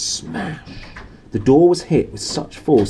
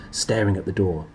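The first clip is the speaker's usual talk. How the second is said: ordinary delivery, nothing marked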